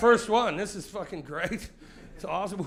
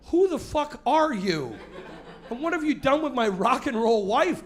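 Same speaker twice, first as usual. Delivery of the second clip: stunned outrage; 6 semitones higher, 5 dB louder than usual. Acted. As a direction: demand answers, aghast. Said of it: "stunned outrage at wife".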